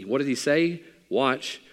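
ordinary talk, nothing marked

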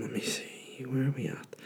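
near-whisper to himself; very soft, voiceless thinking aloud; muttering. Real, not acted. Host hunting for the next question, muttering under his breath.

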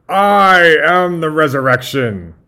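loud, high, slow proclamation; Doctor Frankenstein. Acted. Doctor proclaims to Igor, booming and theatrical. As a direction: declare it with megalomaniac grandeur. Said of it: "starting with Doctor Frankenstein".

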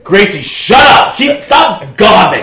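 slow mock shout at a dog. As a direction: yell at the dog. Yelling at dog in roleplay.

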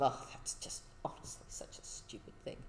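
dismissive hushed aside; scornful whisper. Retold or imitated. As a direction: whisper it with contempt. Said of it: "shaking your head with this sort of".

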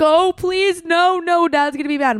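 high, loud mock pleading; pleading kid. Dad imitating his son begging not to unleash, mock panic. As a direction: beg like a kid scared of getting in trouble. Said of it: "And Kevin's like".

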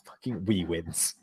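put-on mumbled Scottish accent; slow, low Scottish muttering. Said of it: "You can at least do it in a Scottish accent".

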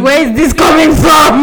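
real bafflement. Real, not acted. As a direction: exclaim it, baffled and amused. Baffled by a question about men.

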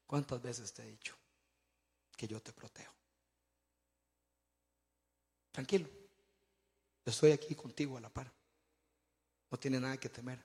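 soft, low, calm reassurance; Jesus. Acted. Jesus comforting a frightened passenger beside him, calm and tender. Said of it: "Y dice".

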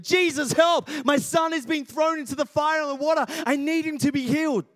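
father begging Jesus, shouting; desperate shout. Retold or imitated. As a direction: yell like a father whose son is in danger. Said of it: "he probably wasn't saying it like that".

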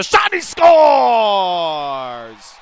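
goal call shout. Real, not acted. Goal call in hockey broadcast.

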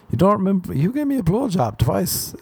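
high indignant retort, pitched well up; mock indignant. Telling off the girl who forgot him, high and mock-indignant. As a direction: act wounded and outraged, high. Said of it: "I wanted to say to that girl".

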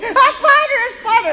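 very high, loud mock scream; hammed-up shriek of a terrified wife; Janice. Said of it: "Janice is screaming".

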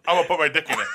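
half-laughed shouted line; laughing shout. Acted. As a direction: blurt it out through laughter. Joking catchphrase shouted laughing.

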